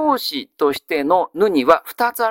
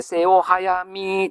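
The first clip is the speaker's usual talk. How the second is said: slow recitation; much slower than usual. Shown as a demonstration. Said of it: "teacher quoting classical phrases".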